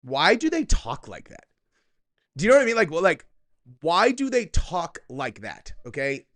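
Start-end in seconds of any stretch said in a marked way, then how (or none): 0.0-1.4 exaggerated, swooping imitation of their inflection; mocking their inflection. Host mocking the way the women in the clip talk, exaggerated inflection. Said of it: "something about their inflection". 3.8-5.7 slow, drawn-out mimicry of an annoying inflection; mocking their inflection. Host complaining about how they talk, mockingly drawn-out inflection. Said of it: "something about their inflection".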